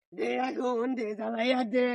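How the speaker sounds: usual speaking voice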